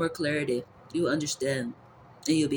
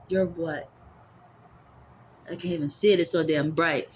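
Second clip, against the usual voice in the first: ordinary talk, nothing marked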